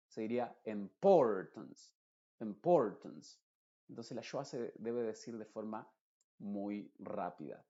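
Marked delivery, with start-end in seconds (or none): 0.6-3.4 "importance" con PORT fuerte y alargado; acento en PORT. Shown as a demonstration. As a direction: golpea la sílaba "port" de "importance". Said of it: "tiene el estrés es el port". ("importance" con la sílaba central marcada y la final reducida)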